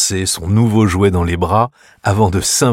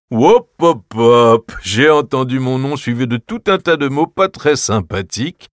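higher, louder jovial interruption; smiling uncle; 4 semitones higher, 4 dB louder than usual. Acted. Uncle Drosselmeyer stops the quarrel, jovial and smiling. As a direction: break up the fight cheerfully. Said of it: "dit-il en souriant".

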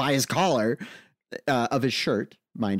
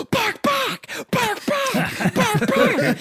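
put-on emphatic toddler barking; much slower than usual. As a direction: bark like a wound-up toddler. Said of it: "he gets more and more emphatic".

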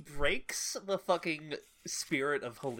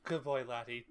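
normal talking voice

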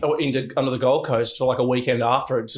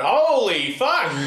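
drawn-out pained yell, higher; retold pain shout; 5 semitones higher, much slower than usual. Guest retelling the tattoo needle on his dick, pained yell. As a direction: stretch it out like sudden pain. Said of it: "I went".

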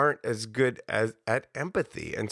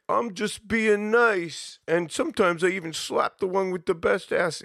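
bro guy; 9 semitones higher, 3 dB louder than usual. Retold or imitated. Retelling the catcaller from the radio piece, smug bro voice. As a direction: play the swaggering bro who thinks he's charming. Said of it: "bro-y guy who was like".

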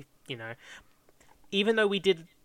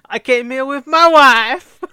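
put-on much higher, very loud, slow Borat accent; Borat impression; 12 semitones higher, 19 dB louder, slower than usual. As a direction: stretch it out, proud and hammed-up, as Borat. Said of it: "Sacha Baron Cohen as the tour guide".

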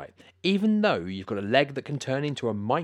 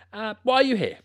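surprised; 13 semitones higher, 8 dB louder than usual. Acted. Host surprised by odd guest.

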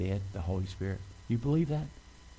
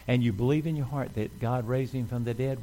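ordinary talk, nothing marked

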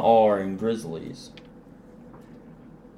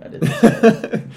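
laughing it off, higher; 12 semitones higher, 7 dB louder than usual. Real, not acted. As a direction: laugh through the denial. Caught saying the rude word.

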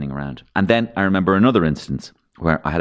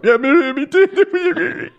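high, loud, choked-up guest giving in; teary guest; 5 dB louder, much slower than usual. Retold or imitated. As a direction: play the guest about to cry on air. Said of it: "the two people are going".

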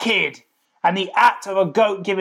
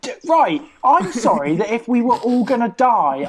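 normal talking voice

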